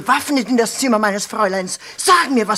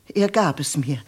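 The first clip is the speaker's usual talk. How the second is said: ordinary talk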